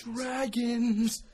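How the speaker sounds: high, loud excited cry; excited shout. Real, not acted. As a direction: yell it with glee. Excited shout about dragons.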